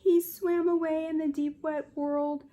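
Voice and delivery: ordinary talk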